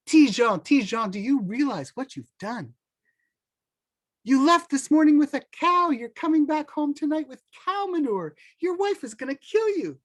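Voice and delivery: a merchant shouting after Tijan in disbelief; exasperated call. Acted. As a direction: chase him down with the news, aghast.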